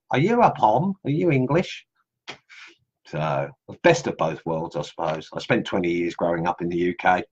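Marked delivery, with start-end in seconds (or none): 0.1-1.8 high, questioning mock Aussie query; imitating Aussie locals. Locals in Australia quizzing him, high-pitched mock Aussie accent. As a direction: lightly mimic Aussies asking if he's English, pitched up high. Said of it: "But here they say".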